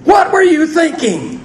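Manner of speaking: high, incredulous question thrown at Peter; exasperated disbelief. Pretend. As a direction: ask Peter, stunned, what he was thinking. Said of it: "question I want to ask Peter".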